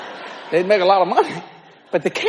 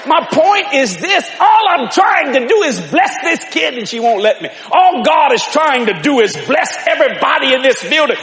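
loud, driving, higher preaching cadence; full-force preaching; 5 semitones higher, 3 dB louder than usual. Acted. Preacher to the congregation about God's blessing, shouting and building. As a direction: hammer the blessing home to the congregation.

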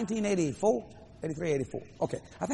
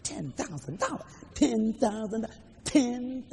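struggling disbelief; 4 semitones higher, much slower than usual. Retold or imitated. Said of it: "I am struggling with this".

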